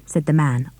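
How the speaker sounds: ordinary delivery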